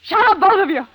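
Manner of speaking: fed-up shout; shouting shut up. Acted. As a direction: cut them off with a yell. Woman silences two quarrelling men.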